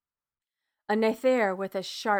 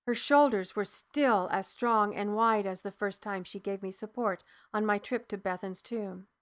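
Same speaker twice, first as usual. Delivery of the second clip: usual speaking voice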